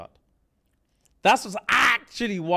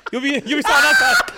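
loud, higher mock shout at the mourners; playful shout; 10 semitones higher, 8 dB louder than usual. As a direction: call it out like a joke order.

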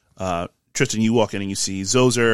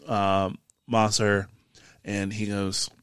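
ordinary talk, nothing marked

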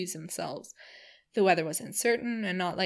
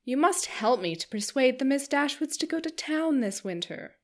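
gushing, higher society lady, talking on and on; chattering Missus Palmer; 6 semitones higher, 4 dB louder than usual. Acted. Missus Palmer gushing to the Dashwood sisters, higher and eager. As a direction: bubble over like a giddy hostess.

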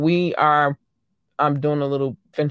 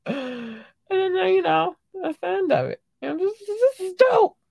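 high squeaky rush of delight; excited. Acted. Host wrapping up her new-country reaction, excited squeal. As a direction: keep it up in a giddy high squeal. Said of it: "I get excited about it".

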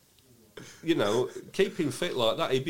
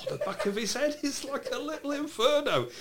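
amazed; 13 semitones higher than usual. Real, not acted. Marvelling at red head.

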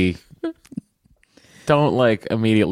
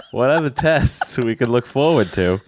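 laughing through the words; giggling while reading aloud; 4 semitones higher than usual. Acted. Breaks up laughing reading email.

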